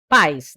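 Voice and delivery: pais said unbroken, as a monosyllable; one firm beat on pais; one syllable. Shown as a demonstration. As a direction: keep the vowels of pais joined. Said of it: "não separa isso".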